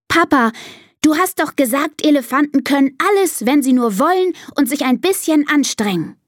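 put-on high child's voice; pleading daughter. Elephant daughter reminding Papa of his words, high pleading. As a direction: reproach Papa in a high little-girl voice.

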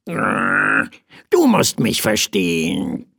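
slow croaky bird voice; explaining bird. A narrator's reading. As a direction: croak it slowly, pleading for understanding. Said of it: "begann der Vogel zu erklären". (erklärende, krächzende Vogelstimme)

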